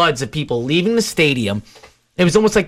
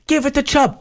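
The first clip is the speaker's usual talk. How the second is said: much higher imitated fan shout; mock yell; 12 semitones higher than usual. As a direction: bark the play call like the know-it-all fan. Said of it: "this guy consistently yells".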